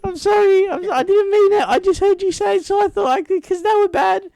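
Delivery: put-on very high, loud little-kid pleading; mimicked apologetic child. Child apologising to Daddy for swearing, high mimicked pleading. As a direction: beg Daddy in a squeaky mock child voice.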